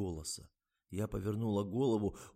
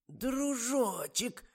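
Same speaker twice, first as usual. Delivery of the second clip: высокий, очень медленный укор; мягко стыдит, как старушка; укоризненно; 5 dB louder, much slower than usual. A narrator's reading. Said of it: "укоризненно сказала старуха". (укоризненное протяжное обращение)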